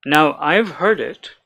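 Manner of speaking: ordinary talk, nothing marked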